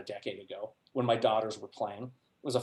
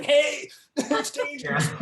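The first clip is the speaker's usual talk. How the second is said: parent yelling; 5 dB louder, slower than usual. Retold or imitated. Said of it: "you're yelling at me".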